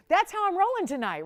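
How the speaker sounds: gleeful laughing brag. Real, not acted. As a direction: laugh it out, pleased with yourself. Laughs at her own markdowns.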